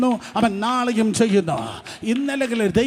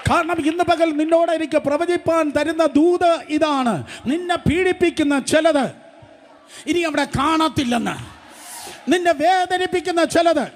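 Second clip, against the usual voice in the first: full-force preaching; 8 semitones higher than usual. Acted. Preacher proclaiming God's message to the congregation, shouting. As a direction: cry each line out to the congregation like a prophet.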